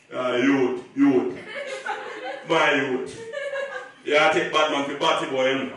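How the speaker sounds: deep, menacing Jamaican patois, low and loud; a hostile Jamaican dad scolding his daughter's fiancé; Jamaican father. Retold or imitated. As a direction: growl it like a scary Jamaican dad. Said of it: "his reaction was".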